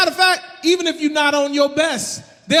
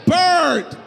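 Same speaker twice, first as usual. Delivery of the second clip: higher, insistent retort; insistent correction; 5 semitones higher, 4 dB louder than usual. Retold or imitated. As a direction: insist on your name, pitched up. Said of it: "I'm like".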